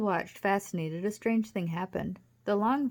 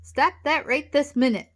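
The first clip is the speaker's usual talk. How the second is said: loud, high, emphatic command; shouted demand; 7 semitones higher, 9 dB louder than usual. A narrator's reading. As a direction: order it sharply at full voice. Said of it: "Wally shouted".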